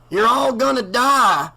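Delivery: prophesy death to the crowd; doom-saying preacher. A narrator's reading. The blinded reverend warns the townies, prophetic.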